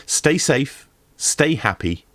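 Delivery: usual speaking voice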